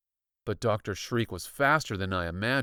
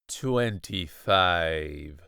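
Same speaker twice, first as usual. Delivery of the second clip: chapter heading; 6 semitones lower, much slower than usual. A narrator's reading. Chapter number read out.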